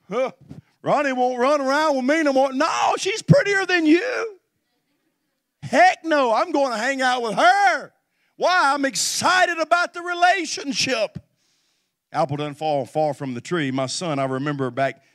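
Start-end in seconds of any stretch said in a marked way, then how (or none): 0.1-4.4 jealous friends. Retold or imitated. Friends voiced jealous, loud. 5.6-11.2 put-on excited young lover. Young lover answering friends, loud.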